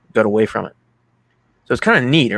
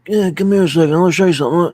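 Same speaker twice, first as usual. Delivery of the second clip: higher, louder mock old mobster voice; imitating Don Vito; 7 semitones higher, 6 dB louder, faster than usual. Don Vito teasing his grandson, imitated high and loud. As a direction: put on a playful movie grandpa, pitched up and loud.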